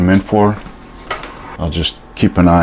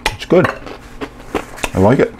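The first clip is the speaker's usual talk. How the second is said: talking with a mouth full; chewing while speaking; 7 semitones higher than usual. Real, not acted. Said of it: "Excuse me for talking with my mouth full".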